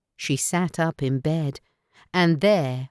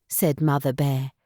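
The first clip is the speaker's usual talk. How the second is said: ordinary delivery, nothing marked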